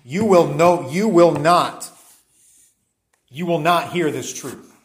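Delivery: loud, solemn pronouncement of judgment; ringing judgment sentence, slowed on the second line; stern proclamation. Retold or imitated. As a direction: declare it like a verdict from the pulpit. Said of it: "a proclamation of judgment against them".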